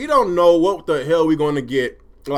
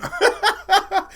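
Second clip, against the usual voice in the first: high-pitched burst of laughter; 14 semitones higher than usual. Real, not acted. Streamer laughing at his own frustration, high laugh.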